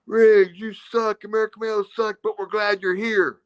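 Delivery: hammed-up fan heckle; jeering crowd. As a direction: chant it like a heckling Philly crowd. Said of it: "they'd be cursing me going".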